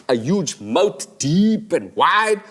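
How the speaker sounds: slow, drawn out words; ominous build. Acted. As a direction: savour each word slowly. Describing menacing moat.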